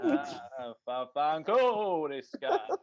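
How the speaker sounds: hammed-up mobster babble with a laugh; mock Italian noises. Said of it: "it's digging into the beef".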